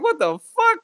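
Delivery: high, shocked squeak; incredulous. Retold or imitated. Reacting to pricey vending machine, high shocked squeak. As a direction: yelp it up high in disbelief. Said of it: "I was like".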